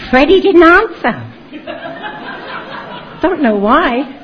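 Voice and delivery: high, loud laugh through the words. Real, not acted. Laughs at the unanswered letter.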